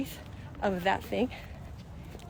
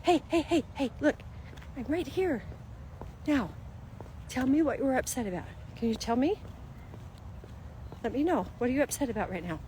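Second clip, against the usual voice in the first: higher, soft coaxing voice; soothing a child; 4 semitones higher, 5 dB quieter than usual. Retold or imitated. Parent coaxing an upset little kid to talk, soft and higher. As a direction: gently draw the child out. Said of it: "So if we've got a little kid".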